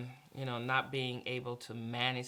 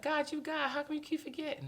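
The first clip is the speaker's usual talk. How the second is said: incredulous question; 14 semitones higher than usual. Retold or imitated. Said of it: "I was like".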